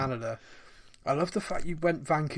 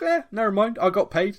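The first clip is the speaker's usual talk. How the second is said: shrugging player; 6 semitones higher, 5 dB louder than usual. Retold or imitated. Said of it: "absolute shrug".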